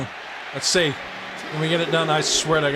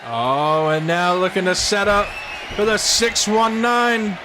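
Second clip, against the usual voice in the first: shouted play-by-play; 8 semitones higher than usual. Acted. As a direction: scream the near falls like a TV announcer. Commentary at full force.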